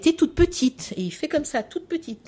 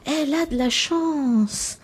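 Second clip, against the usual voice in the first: high, slow, breathy-sounding marvelling "oh"; teacher's delighted exclaim; 7 semitones higher, much slower than usual. Retold or imitated. Teacher praising a child's story, slow high delight. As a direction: gush over the child's news like a preschool teacher. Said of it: "elle respire fort, elle fait".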